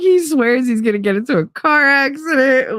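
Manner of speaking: high-pitched, whiny mock distress; hammed-up wail easing off. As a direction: relay his panic in a teary mocking voice. Mockingly reporting his panic to friend.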